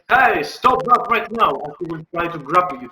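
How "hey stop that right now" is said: higher, louder, slow stern shout; guard yelling. Acted. Guard running at Talius, slow stern yell. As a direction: order it slowly as you charge in. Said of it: "The guard will run towards Talius and, uh, say".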